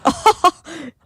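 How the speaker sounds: big loud guffaw; guffaw laugh. Shown as a demonstration. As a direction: throw your head back and guffaw. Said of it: "guffaw is kind of like".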